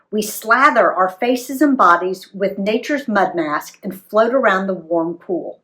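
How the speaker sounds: plain reading voice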